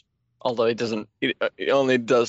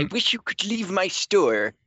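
higher sardonic shopkeeper voice; comic book guy; 9 semitones higher than usual. Retold or imitated. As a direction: grumble like a nerdy shop owner. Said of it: "comic book guy".

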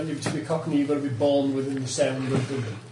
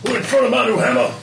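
hammed-up mimicry; 9 semitones higher, 6 dB louder than usual. Game master riffing on a player's new hammer, hammed-up mimicry. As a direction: ham it up high and loud. Hams up mock in-character exclamation.

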